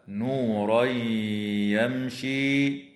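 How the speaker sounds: slow chanted "نورا يمشي" with held ghunna; idgham with ghunna. Shown as a demonstration. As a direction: merge the tanween of "نورا" into a long nasal y of "يمشي". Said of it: "ويدغم في الياء مع الغنة". (إدغام تنوين "نورا" في ياء "يمشي" بغنة)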